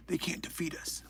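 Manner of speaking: hushed mock-rally whisper; very soft, voiceless whisper. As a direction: drop to a whisper for the rallying line.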